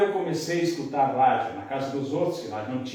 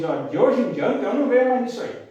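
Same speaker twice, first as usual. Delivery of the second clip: quoting Vicente; 7 semitones higher, 5 dB louder than usual. Retold or imitated. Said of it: "Daí o Vicente falou pra mim".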